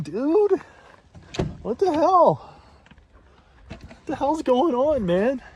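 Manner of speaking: high, loud, thrilled exclamations; excited shouting. Real, not acted. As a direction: yell with delight at the snow. Excited reaction to falling snow.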